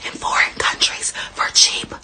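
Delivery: breathy whispered rant, half-mocking; soft conspiratorial whisper; dramatic whisper. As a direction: hush it like a secret confession over music. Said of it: "dramatic whispered rant over music".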